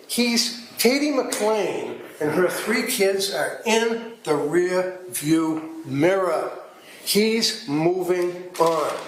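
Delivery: very slow, drawn-out phrases; measured courtroom emphasis, with weighty gaps; deliberate emphasis. Real, not acted. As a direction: stretch it out word by word to drive it home. Lawyer drives point home slowly.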